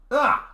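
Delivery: short high loud scream; startled scream. Acted. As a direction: yelp in sudden fright. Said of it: "My scream".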